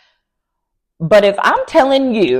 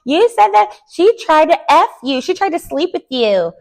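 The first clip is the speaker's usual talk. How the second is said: lightly higher, pointed retelling; a hint of a pointed accusation, pitched up; mock accusing Portia; 10 semitones higher than usual. Portia confronting Dennis about Drew, lightly higher and accusing. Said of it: "Portia was like".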